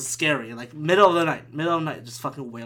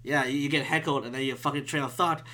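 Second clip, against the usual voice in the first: ordinary talk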